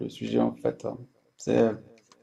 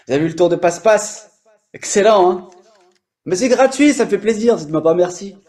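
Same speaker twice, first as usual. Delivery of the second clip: playful excitement; 7 semitones higher, 6 dB louder than usual. Real, not acted. Jokey boast about the trick.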